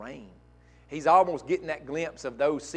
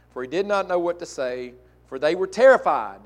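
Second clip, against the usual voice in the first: scripture reading; 4 semitones higher, 10 dB louder than usual. Acted. Scripture read in preaching voice.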